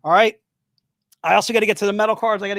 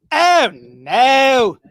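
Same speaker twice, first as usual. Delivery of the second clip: dismayed oh no; 3 semitones higher, 6 dB louder than usual. Real, not acted. Host dismayed the website is down, crying out. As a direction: wail it like a small disaster.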